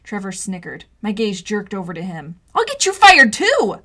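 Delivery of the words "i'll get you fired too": much higher, loud, slow angry bark; furious threat; snapped. Acted. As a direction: snap it at the snickerer. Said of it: "I snapped at him".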